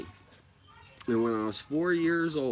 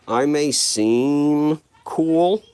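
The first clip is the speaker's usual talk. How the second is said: mock cool; 4 semitones higher, 11 dB louder, much slower than usual. Bragging mock-cool to chat.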